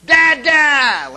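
put-on loud, high child's shout for dad; booming high-pitched mock toddler yell; real loud toddler. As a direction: imitate a baby blurting out at full volume. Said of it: "Like, real loud".

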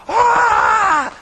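loud high cheering yell; fan scream. Retold or imitated. Pastor imitating fans when a touchdown scores, yelling. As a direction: let out a crowd roar.

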